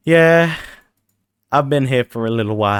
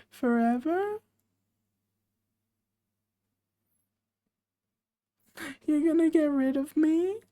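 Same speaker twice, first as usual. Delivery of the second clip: high, softer hurt squeak; squeaky pleading voice; 11 semitones higher, 5 dB quieter than usual. Pretend. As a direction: plead like an abandoned little creature. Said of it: "you do that voice again".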